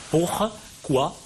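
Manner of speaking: "Pourquoi" broken into pour, quoi with a gap; divided into syllables. Shown as a demonstration. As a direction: count out the two syllables of "Pourquoi". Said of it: "on divise les vers ici en syllabes".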